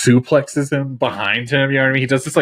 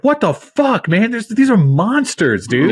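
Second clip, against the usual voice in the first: shocked; 8 semitones higher than usual. Retold or imitated. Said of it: "you're like".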